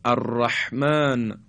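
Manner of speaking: الرحمن slowly with a tight, husky ح; constricted ح. Shown as a demonstration. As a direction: squeeze the throat on the ح of الرحمن. (الرحمن با گرفتگی حلق در ح)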